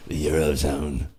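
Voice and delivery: low, slow London growl; mock gruff Londoner; Jason Statham. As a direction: imitate a soft-growling London squawk announcer. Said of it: "It really did sound like Jason Statham".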